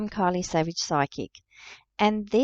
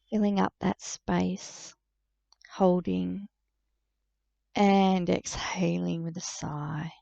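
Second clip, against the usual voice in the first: guided meditation. Real, not acted. Psychic guiding listeners to relax into breath and body, calm meditative delivery. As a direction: lead them gently inward, leaving space to breathe.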